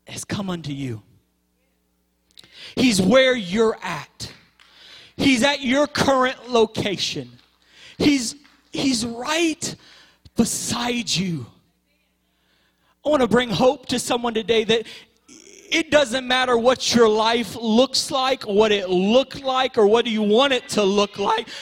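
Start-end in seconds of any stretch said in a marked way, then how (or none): 2.7-11.5 each phrase slowed and lifted; preacher slowing to drive it home; slow emphatic preaching. Acted. 13.0-21.5 louder and higher, rising to a shout; preacher rising to a cry of hope; building preaching shout. Acted.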